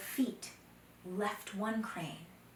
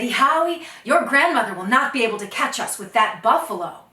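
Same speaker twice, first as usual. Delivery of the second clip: confident boast; 3 semitones higher, 15 dB louder than usual. Acted. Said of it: "Oh, Dakota laughed and he said".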